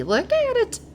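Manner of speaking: high, delighted squeal over a gift; excited gushing. Real, not acted. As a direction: coo it like you can't believe how pretty it is.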